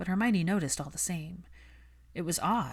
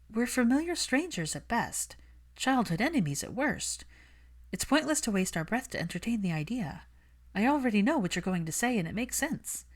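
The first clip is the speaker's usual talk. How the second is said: put-on lightly raised, brisk young woman; Hermione's voice; 6 semitones higher than usual. Hermione brushing off Malfoy, cool and defensive. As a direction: keep her cool and a little defensive.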